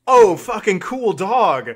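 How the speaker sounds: hammed-up sarcastic mother; sarcastic mom. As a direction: ham up fake enthusiasm. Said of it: "was she saying".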